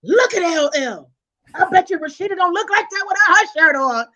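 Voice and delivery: high, loud, excited grandma impression; grandmother's voice. Grandmother admiring a star on TV, imitated loud excitement. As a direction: imitate grandma gushing over the TV. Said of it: "I hear her voice in my head".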